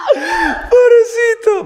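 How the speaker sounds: very high, slow, shrieking through laughter; cracking up while calling him poor thing; howling with laughter; laughing pity. Real, not acted. Laughing at Michael hitting the light.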